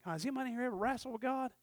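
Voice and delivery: ordinary delivery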